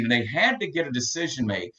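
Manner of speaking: ordinary talk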